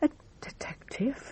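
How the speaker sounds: hushed, breathless whisper of surprise; a shocked, barely voiced "A detective?"; startled whisper. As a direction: catch your breath and whisper it, caught off guard. Widow startled by news of detective.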